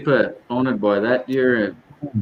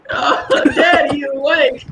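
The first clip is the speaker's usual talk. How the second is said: high-pitched, laughing through the words. Retold or imitated. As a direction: crack up while asking if Dad is awake. Retelling teasing his sleepy dad.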